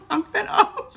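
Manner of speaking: high, squeaky real laughter through the words; laughing. Real, not acted. Ranting to viewers about the show, breaking up laughing. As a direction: crack up while trying to talk.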